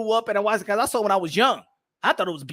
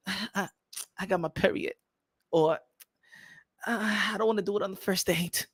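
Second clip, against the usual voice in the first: soft, hesitant excuse; lightly voiced girl, quietly stalling; reluctant girl; 3 semitones lower, 8 dB quieter than usual. Girl putting off the first date, lightly voiced and reluctant. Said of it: "the girl be like".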